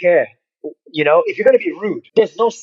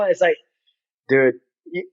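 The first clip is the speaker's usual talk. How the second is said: ordinary delivery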